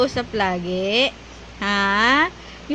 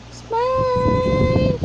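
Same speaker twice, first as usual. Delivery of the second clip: high-pitched coaxing; 8 semitones higher than usual. Real, not acted. Coaxing baby to smile again.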